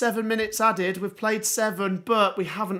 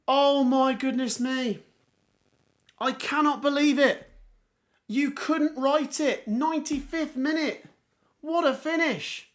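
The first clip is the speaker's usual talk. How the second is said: ordinary talk, nothing marked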